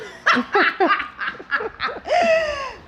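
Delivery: real high burst of laughter. Real, not acted. Laughs at her own joke.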